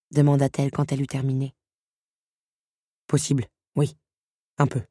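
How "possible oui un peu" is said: reluctant beast, conceding bit by bit; grudging. A narrator's reading. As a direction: give in grudgingly, in short pieces. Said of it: "concéda à contre-cœur le maître". (concession à contrecœur)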